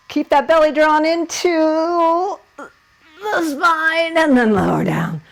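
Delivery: effortful trembling instruction; strained from effort. Real, not acted. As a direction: squeeze the words out mid-pose. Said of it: "I'm really shaking".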